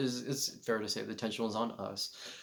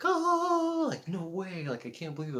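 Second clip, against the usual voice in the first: delighted disbelief; 10 semitones higher, 6 dB louder than usual. Retold or imitated. Customer's delighted reaction retold.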